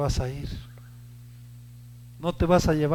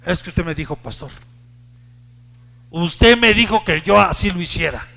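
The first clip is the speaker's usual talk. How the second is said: a touch of blame, getting louder; mild accusing complaint; 8 dB louder than usual. Retold or imitated. A congregant mildly blaming the pastor for his advice. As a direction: pin it back on the pastor. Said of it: "si algo sale mal".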